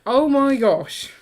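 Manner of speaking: drawn-out, high, loud oh my gosh; slow stunned exclaim; shocked gasp. Real, not acted. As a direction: reel back in disbelief. Shocked at messy Lego.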